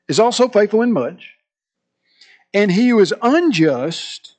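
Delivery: emphatic, measured Bible reading with pauses; scripture reading. Acted. Preacher reads Luke to the congregation, emphatic and deliberate. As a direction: proclaim the verse phrase by phrase.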